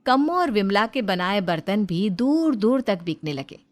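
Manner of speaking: ordinary delivery, nothing marked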